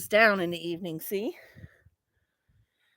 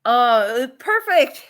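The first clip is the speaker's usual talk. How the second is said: delighted; 7 dB louder, much slower than usual. Real, not acted. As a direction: cry out with joy. Delight at the greenhouse.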